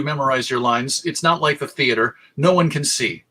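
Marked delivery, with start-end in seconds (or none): none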